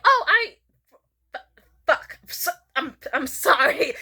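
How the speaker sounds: loud high "oh", then stammering; flustered apology. Retold or imitated. Caught out at open mic, flustered and stammering. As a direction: stumble into a mortified, acted apology.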